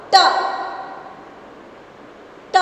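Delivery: T said alone, crisp and separate; isolated T sound. Shown as a demonstration. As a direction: sound out just the letter T. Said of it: "I will tell a ending sound".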